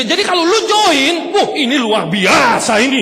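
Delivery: loud hammed-up hype pitch; mock hype. Mocking a presenter hyping the business to prospects, loud mock hype. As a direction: oversell it loudly.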